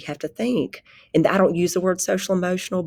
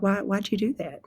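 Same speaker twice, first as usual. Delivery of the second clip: calm question; 4 semitones higher than usual. Retold or imitated. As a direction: ask gently, without accusing. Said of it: "if we can stay calm".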